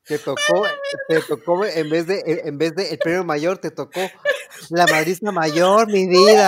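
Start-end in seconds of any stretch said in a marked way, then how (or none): 4.7-6.5 loud, slow, teasing proclamation; hammed-up loud taunt; mock announcement. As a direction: call it out like a raffle prize.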